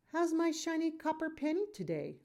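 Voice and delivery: father cooing at his daughter; playful dad greeting. A narrator's reading. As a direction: greet your little girl fondly.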